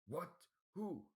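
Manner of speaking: high, outraged demand; furious. A narrator's reading. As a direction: spit the question in rage. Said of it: "He now seemed even more furious".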